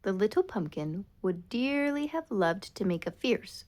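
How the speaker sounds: plain reading voice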